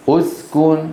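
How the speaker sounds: أسكن as "us-kun", starting on u; opening damma. Shown as a demonstration. Teacher answering how we say it, with damma. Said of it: "فنضم الهمزة. ماذا نقول؟".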